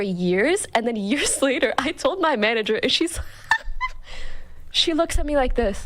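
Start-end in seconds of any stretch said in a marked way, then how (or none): 3.2-4.6 breathy squealing laugh; airy high laughter bursting out. Real, not acted. Laughing at the memory.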